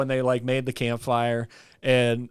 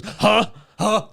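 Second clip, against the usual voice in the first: imitated huh; 6 semitones higher than usual. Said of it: "and he just goes".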